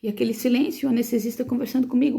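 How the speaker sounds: usual speaking voice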